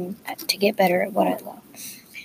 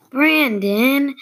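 higher, drawn-out group-style yell; yelled name; 5 semitones higher, 3 dB louder than usual. A narrator's reading. Whole team calling out a teammate, yelling. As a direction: stretch the name out as a scolding call. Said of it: "and everybody yells".

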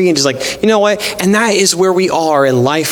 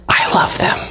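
hushed, breath-only declaration of love; tender whisper; 3 semitones higher than usual. Acted. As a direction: whisper it as God's gentle answer. God's tender answer to angry prayer.

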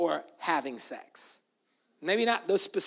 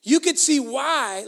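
ordinary talk, nothing marked

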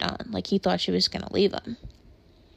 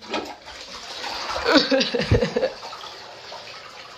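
breathless, high nervous laugh after the scare; real giddy relief laugh; shaky relieved laughter; 5 semitones higher than usual. Real, not acted. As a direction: laugh the panic off. Laughing after catching spider.

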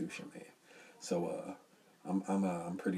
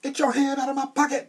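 high, loud mock shriek; 10 dB louder than usual. Co-host acting out the pocket moment, loud high mock shriek. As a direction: ham it up like a movie scene. Co-host mimics the stripper scene.

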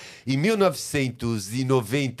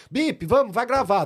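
lightly higher, hurried call to set; a hint of a young producer summoning the guest; producer girl calling; 7 semitones higher than usual.

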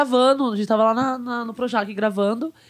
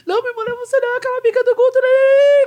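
high-pitched mock crying, building louder; hammed-up weepy protest, pitched way up; retold tearful wail; 11 semitones higher, 5 dB louder, faster than usual. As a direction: imitate her wailing through tears, ending loud. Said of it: "Começou a chorar".

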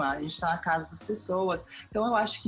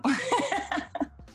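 laugh. Real, not acted. Laughing at own remark.

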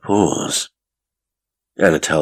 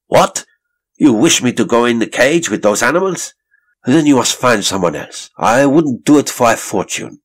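blurting it out higher and louder in sudden fury; violent outburst; 7 semitones higher, 5 dB louder than usual. A narrator's reading. Anatole refusing to enter the cage, violent outburst. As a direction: explode with outrage at the order. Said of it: "he blurted out violently".

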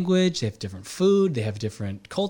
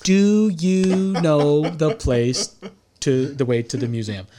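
very slow, louder, drawn-out words to a foreigner; speaking slower; 3 semitones higher, 4 dB louder, much slower than usual. Retold or imitated. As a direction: stretch every word like talking to someone who can't understand. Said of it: "he would just start speaking slower".

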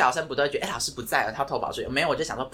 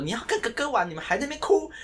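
scolding teacher; 10 semitones higher than usual. Retold or imitated. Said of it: "就骂那妹妹说".

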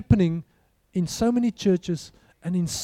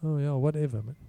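bored brush-off; 4 semitones lower, 5 dB quieter than usual. Pretend. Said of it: "What will the guy say?".

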